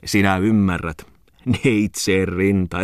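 slow, savoured words ending in a chuckle; chuckling praise. Acted. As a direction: relish the phrase, then laugh. Tommola praises Muttinen, chuckling.

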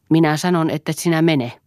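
husband yelling orders at his wife; shouting. Acted. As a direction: shout him down. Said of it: "huusi Eero".

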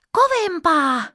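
put-on high, gleeful child's cry; giggly shout. A narrator's reading. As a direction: shout it out high, full of fun. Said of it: "Pippa huusi kikattaen".

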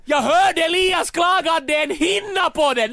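usual speaking voice

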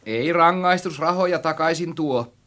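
high, generous-sounding pronouncement; magnanimous. A narrator's reading. Narrator to friend about lost money, magnanimously. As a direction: say it as if nobly forgiving. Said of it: "sanoin jalomieliseen tapaani".

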